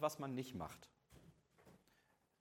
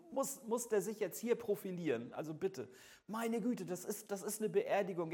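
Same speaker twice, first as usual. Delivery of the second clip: higher-pitched, scandalised grumbling; indignant protest; 6 semitones higher than usual. Acted. Funeral guest objecting to someone showing off, indignantly. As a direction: play the affronted mourner.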